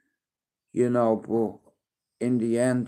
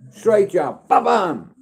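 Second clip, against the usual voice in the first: squeaky, loud ringside calls, slow booms at the end; excited coaching calls; 6 semitones higher, 7 dB louder than usual. Real, not acted. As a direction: hype him up like a tiny fighter in the ring. Dad calling punches for baby.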